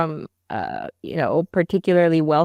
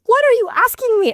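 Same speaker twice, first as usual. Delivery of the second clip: very high, louder bewildered question; incredulous; 5 dB louder than usual. Retold or imitated. Wife to husband at four am, high bewildered cry. Retelling crying confusion.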